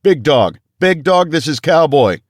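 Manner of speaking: high, loud call sign shout; yelled back. A narrator's reading. Captain yelling his call sign to the trapped man. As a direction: bellow the call sign down the hall. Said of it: "I yelled back".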